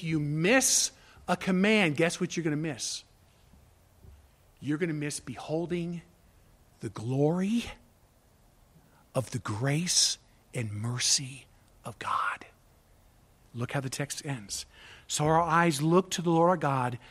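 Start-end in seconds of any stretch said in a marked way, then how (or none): 4.6-12.5 slowing into a low, reverent near-whisper; hushed reverence. Real, not acted. Preacher telling the congregation what they would miss, hushed reverence. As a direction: let each phrase sink softer, with long pauses. Hushed climax on God's mercy.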